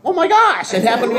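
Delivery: high, loud, laughing exclamation; mock astonishment. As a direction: cry out in playful shock. Acts out shock at grown-up kid.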